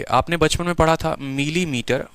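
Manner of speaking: normal talking voice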